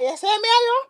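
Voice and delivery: loud, high mock Caribbean voice; hammed-up Caribbean street voice; Caribbean accent. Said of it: "That was a terrible Caribbean accent".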